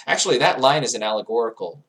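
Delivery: usual speaking voice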